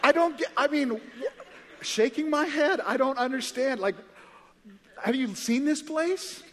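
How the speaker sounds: high, loud, laughing disbelief; baffled amazement. Retold or imitated. Newcomer marvels at being in heaven, hammed-up amazement.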